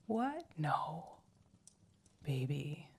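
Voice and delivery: hushed close whisper. Acted. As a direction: breathe it intimately. Intimate roleplay whisper.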